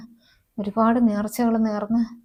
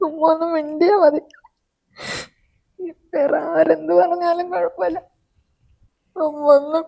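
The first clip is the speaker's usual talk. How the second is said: tearful pleading; 8 semitones higher than usual. Acted. Pleading that mother won't speak.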